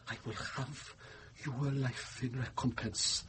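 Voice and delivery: hushed threat. Acted. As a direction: breathe the threat close to his ear. Knifeman vows to kill monk.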